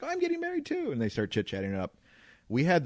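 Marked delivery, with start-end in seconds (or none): 0.0-0.9 high, bright mock squeal of excitement; excited Gabby. Bride-to-be matching a stranger's news, high and excited. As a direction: gush it back, way up high. Gabby voiced chatting at wine bar.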